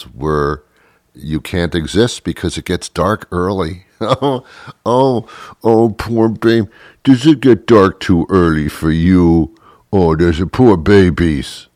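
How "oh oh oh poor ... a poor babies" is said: mock sympathy. Mocking sympathy for winter complainers.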